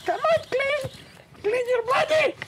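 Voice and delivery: put-on a pushy Filipino nurse nagging in her accent. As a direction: Filipino accent, nurse scolding him to shower. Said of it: "there was a Filipino nurse".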